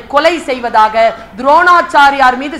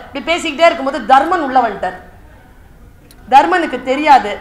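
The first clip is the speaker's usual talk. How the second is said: ordinary delivery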